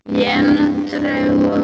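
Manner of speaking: echoey empty room. Real, not acted. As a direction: voice ringing in a bare apartment. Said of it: "quizá escuchan el eco".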